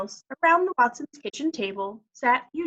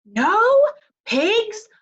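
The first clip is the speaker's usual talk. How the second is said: high, very slow drawn-out yell; shouted; 12 semitones higher, 7 dB louder, much slower than usual. A narrator's reading. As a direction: stretch it out in a shout. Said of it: "Eugenia shouted".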